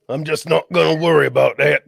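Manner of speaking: lightly put-on pirate accent; a hint of a pirate growl, shrugging it off; mock pirate accent. Pirate brushing off worry about his voice, with a light pirate accent. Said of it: "I don't know what accent it was".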